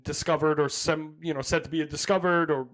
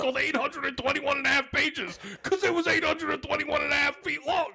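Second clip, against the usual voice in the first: mock nerdy date; 10 semitones higher than usual. Host mocking cohost's Titanic rant on a date, high and hammed-up. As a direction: parody him rattling off trivia, way up high. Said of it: "you were like".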